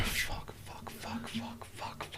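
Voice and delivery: rapid whispered curses; panicked whisper. Retold or imitated. Panic when rival reps the car.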